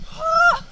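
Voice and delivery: high yelp of pain. Real, not acted. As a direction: yelp as it hurts. After painful wipeout.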